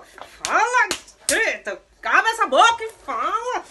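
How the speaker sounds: loud, high, slow drawn-out yell; furious yell. Real, not acted. Mom yells at son to open his mouth. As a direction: drag every word out in rage.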